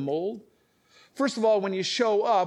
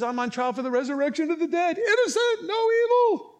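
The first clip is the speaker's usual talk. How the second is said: very high mock outcry, climbing; crying out; 11 semitones higher than usual. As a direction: wail the defence in a strained high voice. Re-enacting the cries in the council.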